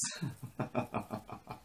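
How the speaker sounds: breathy, voiceless laughing fit; helpless airy cackle; wheezy laugh. Real, not acted. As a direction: crack up in a soft wheeze. Cracks up at own retelling.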